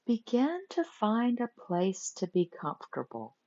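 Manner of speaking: normal talking voice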